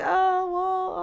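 high, loud, flustered retold reaction; embarrassed. Woman covers for falling asleep on a date, embarrassed. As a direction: squeak it out, acting embarrassed. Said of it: "I was embarrassed".

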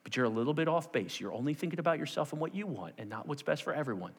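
mild, considerate reproof; gentle correction. Acted. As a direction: break it to him delicately. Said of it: "you would think Jesus would say".

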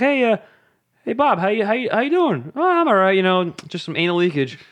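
high-pitched breezy small talk; casual everyday chat. Acted. Two neighbours chatting about anal leakage, breezily casual. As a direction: chirp it as if nothing's odd. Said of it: "just an everyday conversation".

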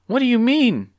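very high, loud, anxious question; nervous, high-pitched worry. A narrator's reading. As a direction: ask it high in alarm. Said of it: "asked Clucky nervously".

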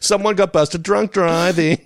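high, slow TV teaser; stretched-out jokey announcement; mock promo tagline. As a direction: sing it out like an episode teaser.

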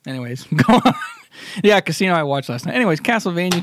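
normal talking voice

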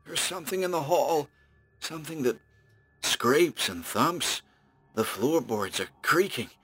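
breaking into panic; terrified. Acted. Chained man hears creature approach.

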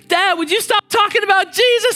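put-on high whiny child voice; child groaning at dad; exasperated kid. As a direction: complain like a fed-up kid. Kid's exasperated complaint.

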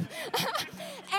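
high crying laugh. Retold or imitated. Hugging laptop, overjoyed.

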